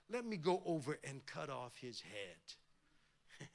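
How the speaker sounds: lightly voiced eager soldier; mild menacing offer. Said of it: "Abishai says".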